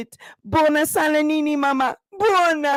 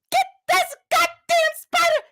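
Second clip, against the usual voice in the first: much higher shriek at the spider; panicked shriek; 14 semitones higher than usual. Retold or imitated. Tasha seeing a spider, panicked high shriek. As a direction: scream for someone to kill it. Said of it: "she said".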